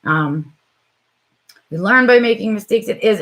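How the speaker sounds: ordinary talk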